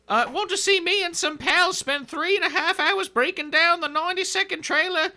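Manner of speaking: reading the comment in a high voice; squeaky comment-reader voice; high put-on voice.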